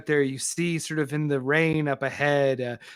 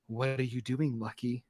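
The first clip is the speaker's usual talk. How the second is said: lightly voiced stern vigilante, softer; a hint of a cold heroine; Scarlet Scorpion; 4 semitones lower, 8 dB quieter than usual. Scarlet Scorpion confronting Lucky, quiet and stern.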